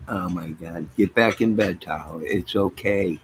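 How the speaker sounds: ordinary talk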